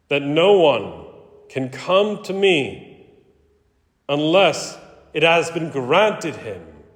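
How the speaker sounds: solemn liturgical reading, Jesus' words lifted; Gospel reading. Acted. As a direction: proclaim the Gospel to the congregation. Priest proclaims the Gospel reading.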